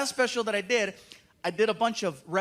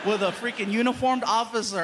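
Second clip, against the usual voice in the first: normal talking voice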